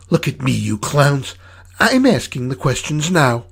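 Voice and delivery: loud, high-pitched angry demand; angry command. Acted. Mama kicks a box and confronts the clowns, angrily commanding. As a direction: take control, loud and high.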